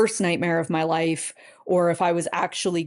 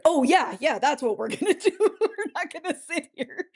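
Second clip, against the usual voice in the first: much higher, quick, laughing "oh yeah"; eager relieved reply; 10 semitones higher than usual. Retold or imitated. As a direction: snap out of shock with a laughing yes. Retold eager reply to husband.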